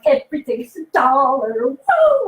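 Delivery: thrilled shopper in the dollar aisle, rising to a whoop; excited cheer. Retold or imitated. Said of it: "and you're like".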